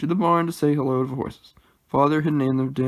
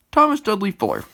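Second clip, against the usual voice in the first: put-on louder, stern father calling the boy out; stern louder father reprimand; stern scolding; 6 semitones higher, 6 dB louder than usual. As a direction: scold him by his full name. Father scolding son in church.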